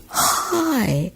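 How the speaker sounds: high, drawn-out hello; imitating an oddly deliberate greeting; loud and slow. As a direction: stretch the greeting out, pitched high. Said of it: "I said it real kinda loud and slowly".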